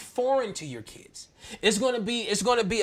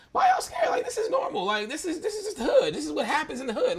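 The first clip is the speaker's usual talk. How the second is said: much higher, amused, laughing through the words; 8 semitones higher than usual. Retold or imitated. Father laughing at his scared kids, high amused disbelief. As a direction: brush off their fear with a laugh.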